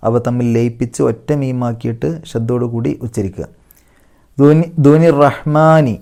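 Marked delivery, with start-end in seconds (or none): 4.4-5.9 slow, louder melodic chant; Quran recitation. Shown as a demonstration. Teacher recites the verse to the class. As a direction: intone the verse slowly. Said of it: "അർ റഹ്മാൻ".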